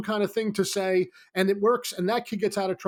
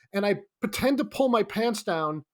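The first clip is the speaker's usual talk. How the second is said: ordinary talk, nothing marked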